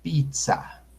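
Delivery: normal talking voice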